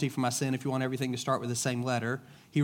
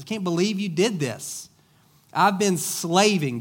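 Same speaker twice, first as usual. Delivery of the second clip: whiny, resentful complaint, louder and higher; a mildly hammed-up indignant older brother; aggrieved older son; 5 semitones higher, 5 dB louder than usual. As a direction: sulk it out like the wronged son.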